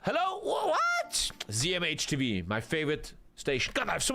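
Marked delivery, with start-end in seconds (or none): 0.0-0.4 high, eager salesman greeting a caller; TV shopping host. Acted. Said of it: "We have a caller". 0.4-1.3 very high, screechy hello; TV caller. Acted. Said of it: "We have a caller".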